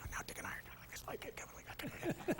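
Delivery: barely audible mock whisper; imitating a cowed, voiceless complaint; retold whisper. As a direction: drop to a tiny hushed voice. Retold cowed aside to stewardess.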